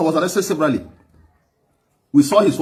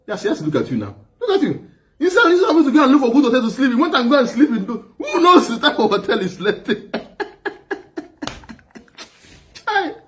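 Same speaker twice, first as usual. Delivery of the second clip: high, helpless laughter through the words; real laughter breaking into the story; laughing hard; 8 semitones higher than usual. Real, not acted. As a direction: talk while cracking up. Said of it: "when I laugh hard like this".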